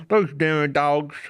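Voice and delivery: slow, gruff grumble; gruff paranoid old man. Pretend. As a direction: grumble it slowly like a suspicious old-timer. Paranoid homeowner voiced.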